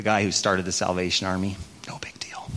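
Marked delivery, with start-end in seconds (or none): 1.9-2.5 dry whispered understatement; whispered sarcastic aside. Real, not acted. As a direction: toss it off in a whisper, tongue in cheek. Sarcastic aside after naming founder.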